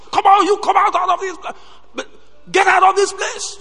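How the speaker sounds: high, loud shout to drive students out. Retold or imitated. Dean shouting students out of the hostel. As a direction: bellow them out of the hostel. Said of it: "it's good to shout like".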